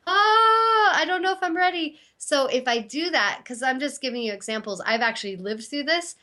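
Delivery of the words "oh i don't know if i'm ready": acting out her own past panic, half in tears; panicky fear. Imitating herself panicking when the gym asks her to teach yoga, high and tearful. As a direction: voice leaping up into a shaky, crying fret.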